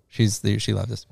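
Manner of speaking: ordinary delivery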